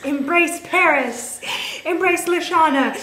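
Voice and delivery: mock-heroic battle cry; rallying cry. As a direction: cheer yourself on grandly.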